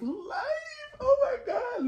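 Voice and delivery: very high, teary fangirl squeal; ecstatic, high-pitched gushing; overwhelmed squeal. Real, not acted. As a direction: stretch it up into a squeaky cry. Overcome by the performance.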